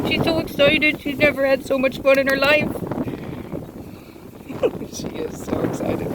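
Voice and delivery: high, laughing, almost squeaky delight; breathless laughing commentary. Real, not acted. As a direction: giggle over the excited puppy. Laughing at the playful puppy.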